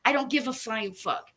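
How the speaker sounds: normal talking voice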